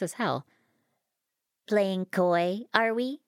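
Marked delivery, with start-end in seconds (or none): 1.7-3.2 higher, lilting needle; coy machine taunt; teasing jab. A narrator's reading. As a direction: needle them playfully, rising at the end. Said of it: "the fabricator jabbed".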